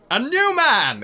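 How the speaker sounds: high, loud and delighted; excited exclamation. Real, not acted. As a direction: cheer it out. Excited reaction to new member.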